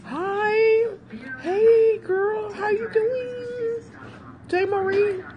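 excited greeting. Real, not acted. Calling her friend, high squealing greeting.

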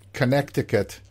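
Connecticut with the middle c sounded as a k; "Connecticut" said as connect-i-cut; mother's pronunciation. Retold or imitated. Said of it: "my mother from France pronounces it".

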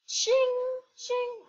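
high ringing ching noise; high ching sound. Pretend. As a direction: mimic a coin chime. Imitating a game sound.

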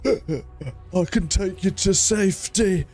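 slow, calm robotic soldier voice; imitating the oblivious rescuer, drawn out slowly; deadpan mock rescuer; mocking NPC voice. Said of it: "and he's like".

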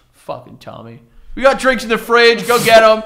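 loud, cheerful bro call; mock party-guy shout. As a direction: wave him in like an old buddy. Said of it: "they were like".

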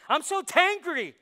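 loud, high, drawn-out comic wail; over-the-top mock complaint; mock angry outburst. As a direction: ham up the exasperation, stretching it out. Acts out invented word for tired-angry.

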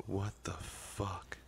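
slow whispered disbelief; a real slow whisper of disbelief; stunned whisper. Real, not acted. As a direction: breathe it out in shock. Stunned at the move failing.

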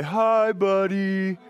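high, bright-eyed elf hello; Buddy greeting. Pretend. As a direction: greet like an overjoyed grown-up elf. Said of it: "Everybody say".

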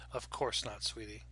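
gentle and soft; soft reassuring. A narrator's reading. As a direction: soothe a worried child. Mother soothing child.

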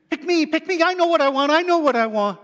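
high, loud, eager owl voice begging to be chosen; squeaky owl waving its wings, pick me. Acted. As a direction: pipe up like a little owl desperate to be picked.

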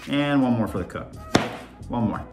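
ordinary delivery

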